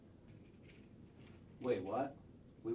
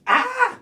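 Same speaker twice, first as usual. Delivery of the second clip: loud, high shriek; loud scream; 12 semitones higher, 16 dB louder than usual. Acted. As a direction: scream in terror. Scream before Strahd arrives.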